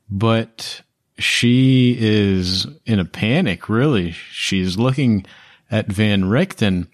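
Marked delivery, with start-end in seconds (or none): none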